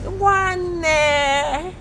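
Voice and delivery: high, drawn-out bird voice; bird-like call. Shown as a demonstration. Teacher has pupils copy a bird, high piping voice. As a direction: pipe it long and high like a bird. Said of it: "whistle it like a bird".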